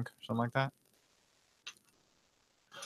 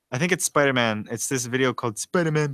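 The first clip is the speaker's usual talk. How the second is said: normal talking voice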